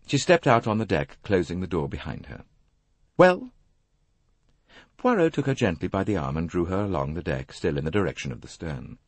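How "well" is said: high, loud, impatient rising question; ungracious. A narrator's reading. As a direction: demand an answer rudely. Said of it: "held the same ungracious tone".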